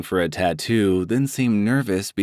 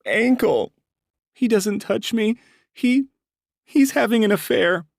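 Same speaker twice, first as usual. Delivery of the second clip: much higher, louder tearful woman's voice; acted tearful confession; crying; 3 dB louder than usual. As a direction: break down as you confess it. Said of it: "I stop when she starts crying".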